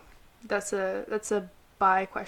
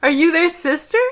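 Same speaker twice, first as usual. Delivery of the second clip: loud, high nosy question; loud high prying ask; 8 semitones higher, 10 dB louder than usual. Retold or imitated. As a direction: blurt it like a nosy stranger. Voicing prying hearing person.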